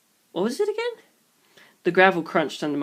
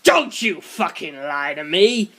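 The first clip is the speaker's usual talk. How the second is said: snarled; slower than usual. A narrator's reading. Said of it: "The thug on my right snarled".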